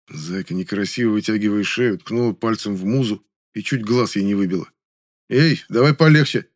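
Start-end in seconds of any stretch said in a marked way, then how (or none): 5.3-6.5 louder, higher check; stern warning. A narrator's reading. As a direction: rein her in. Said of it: "сказал я". (строгое предупреждение)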